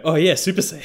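high, loud mock realization; laughing through a comic character quote; imitated inner voices. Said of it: "Trunks's and Goku's inner voice go".

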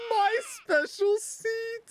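high, slow wail; hammed-up high-pitched whine; mock lament. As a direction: mourn it in a high voice, drawn out. Voices loss of the seat.

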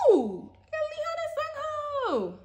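high, slow, drawn-out delight; thrilled. Real, not acted. Vlogger announcing her lucky card pulls, high and thrilled. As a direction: savour every name as a win. Pulls wanted photo cards.

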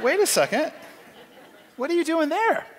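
high, laughing disbelief; can't believe what he's hearing; incredulous officer. Retold or imitated. Commanding officer grilling his spies, high incredulous.

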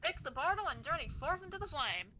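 put-on a touch of old Hollywood transatlantic accent; lightly voiced grand old movie star, transatlantic. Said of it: "you brought back the transatlantic accent".

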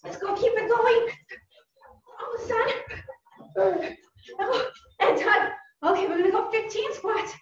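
high, energetic encouragement over a workout; real workout cheerleading; coach calling out. Real, not acted. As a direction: call it out high to keep them moving. Coach calling out during drill.

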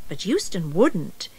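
usual speaking voice

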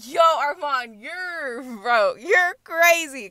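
playful yell. Retold or imitated. Her own reaction, calling out.

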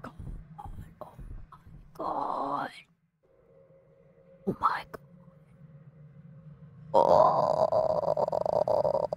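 breathless frightened whisper. Real, not acted. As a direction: whisper so the monster can't hear. Hiding from monster.

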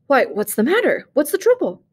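startled husband asking what's wrong; gasped alarm. A narrator's reading. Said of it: "He gasped".

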